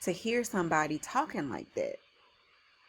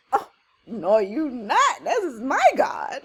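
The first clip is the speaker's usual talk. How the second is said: louder, rising indignant retort; straight-gangster defiance; 6 semitones higher, 8 dB louder, slower than usual. Acted. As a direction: act out a bold, offended challenger. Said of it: "look how David talking".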